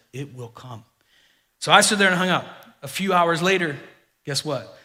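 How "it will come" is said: low, much softer, sure and steady; confident assurance. Retold or imitated. Agent quietly assuring the stranded pastor, confident. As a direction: state it as settled fact, quietly. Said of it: "he confidently looked me straight in the eye and said".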